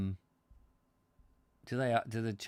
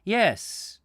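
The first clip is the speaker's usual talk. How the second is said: put-on high, loud Roman-accented voice; 10 semitones higher, 10 dB louder than usual. Centurion agrees with the visitor, Roman accent. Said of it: "he says in Roman accent".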